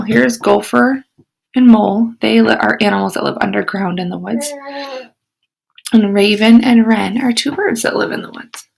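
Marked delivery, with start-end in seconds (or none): none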